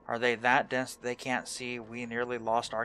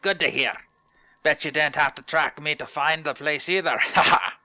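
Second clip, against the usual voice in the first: louder, boisterous put-on trainer voice; hearty hunter trainer; 3 semitones higher, 7 dB louder than usual. Streamer voicing hunter trainer greeting player, jolly put-on voice.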